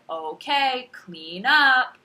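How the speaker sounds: high, loud singsong classroom call; teacher voice. Shown as a demonstration. Kindergarten teacher calling for cleanup, loud singsong teacher voice. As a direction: sing out a routine kindergarten instruction in teacher cadence. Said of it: "my teacher voice".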